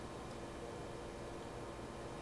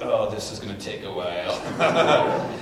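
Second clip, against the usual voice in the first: laughing aside; 6 dB louder, slower than usual. Retold or imitated. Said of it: "I'm like".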